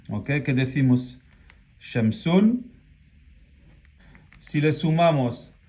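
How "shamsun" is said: ordinary delivery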